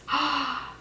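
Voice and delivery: real sharp gasp of dismay; shocked gasp. Real, not acted. Shocked as the story reveals the dog's death.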